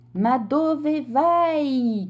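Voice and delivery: high, very slow, drawn-out call; desperate shout. A narrator's reading. Gentleman calling after the sailing nose, slow plea. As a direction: stretch the question out in despair. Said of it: "gridò il signore".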